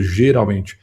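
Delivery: ordinary talk, nothing marked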